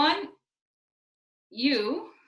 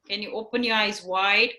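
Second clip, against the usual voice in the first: usual speaking voice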